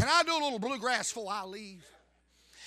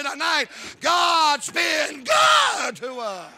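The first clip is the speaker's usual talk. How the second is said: shouted climax; 8 semitones higher, 11 dB louder, much slower than usual. Acted. Preaching climax.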